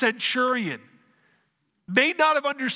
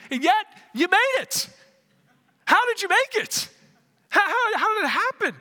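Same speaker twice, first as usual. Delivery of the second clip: incredulous questioning; 11 semitones higher, 4 dB louder than usual. Acted. Preacher quoting a pastor quizzing the thief, astonished. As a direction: voice shooting up high in mock amazement.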